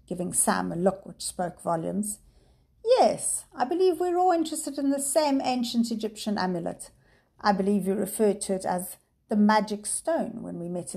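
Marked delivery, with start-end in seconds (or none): 2.8-11.0 high, louder, silky menacing professor; oily villain. Acted. Villain professor toys with the frightened children, high and smooth. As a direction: purr out the threat with false charm.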